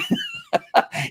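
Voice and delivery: short amused laugh; high laugh. Real, not acted. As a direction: burst out laughing. Laughs at misspelt signs.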